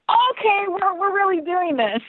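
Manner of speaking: very high, squealed realisation; high-pitched burst of excitement; retold giddy excitement. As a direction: squeal it, mock-panicked and thrilled. Acting her reaction to the email.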